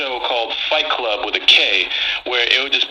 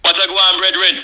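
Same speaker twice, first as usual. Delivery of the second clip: light Jamaican lilt, raised pitch; Jamaican accent; 5 semitones higher, 3 dB louder than usual. Pretend. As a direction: greet him in a Jamaican accent. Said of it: "much better, uh, Jamaican accent".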